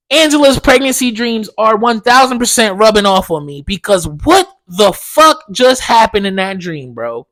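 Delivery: ordinary delivery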